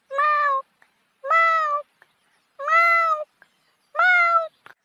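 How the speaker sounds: very high meowing cat. Acted. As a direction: meow like a cat to fool him. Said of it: "मी इकनाल नाही का मांजरेची आवाज काढतो".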